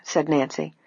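plain reading voice

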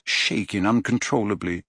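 plain reading voice

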